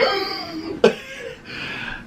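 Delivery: long, high-pitched gasp of mock fright; re-enacted scared gasp. Retelling her scare at the table, frightened gasp. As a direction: suck in air as if badly startled. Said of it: "'cause I was scared".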